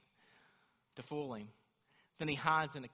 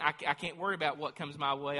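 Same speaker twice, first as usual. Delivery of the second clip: ordinary talk, nothing marked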